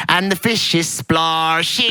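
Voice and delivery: put-on drawn-out, mangled British accent; mimicking bad accent. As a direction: stretch the last word in a botched British accent. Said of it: "She goes".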